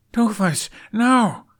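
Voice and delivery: loud, high cry to stop him; urgent shout. Acted. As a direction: yell the warning, panicked. Hiccup stops dragon from firing.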